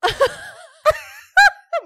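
high shrieking laugh that almost sobs; squealing cry-laugh. Real, not acted. Cracking up at her own story, high squealing laugh. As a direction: let the laugh break into a squeal. Laughing through mock outrage.

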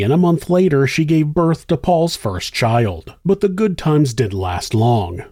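ordinary delivery, nothing marked

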